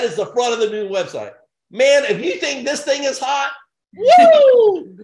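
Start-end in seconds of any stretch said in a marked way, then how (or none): 3.9-5.0 loud high whoop of hype; rally-crowd whoop; excited whoop. Real, not acted. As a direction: let out a big celebratory woo.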